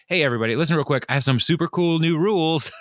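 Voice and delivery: mock-cheerful boss addressing the room; chipper boss. New boss announcing rules to the office, chipper.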